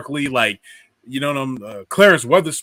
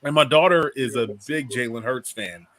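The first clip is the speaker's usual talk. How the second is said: ordinary delivery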